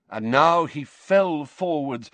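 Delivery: usual speaking voice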